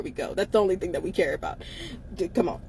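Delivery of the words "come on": ordinary talk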